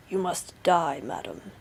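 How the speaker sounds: low voice, dropping at the end; menacing Bluebeard. A narrator's reading. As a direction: pronounce a cruel sentence. Bluebeard sentences his wife.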